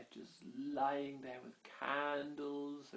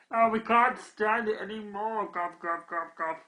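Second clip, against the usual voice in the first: goblin voice; 7 semitones higher, 8 dB louder than usual. Acted. Goblins complain about the pixie.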